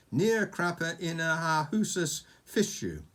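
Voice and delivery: made-up dragon language, read out with relish; mock Dragonese. Reading Oscar a dragon phrase, mock Dragonese. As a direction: sound out the dragon phrase boldly.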